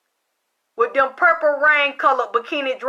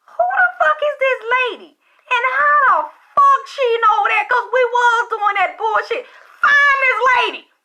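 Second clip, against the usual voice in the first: incredulous outburst; 11 semitones higher than usual. Retold or imitated. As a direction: rant as if the secret just got exposed. Retelling her disbelief at the psychic.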